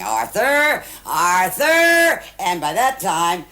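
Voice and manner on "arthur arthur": rising retold shout, higher and louder; mock shout. Wife retelling shouting at the restaurant table, mock shout. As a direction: call the name again across the table. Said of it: "still shouting".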